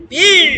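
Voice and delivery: বীর pushed out high and loud; বীর stressed with force; pressed syllable. Shown as a demonstration. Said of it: "চাপ দিবেন".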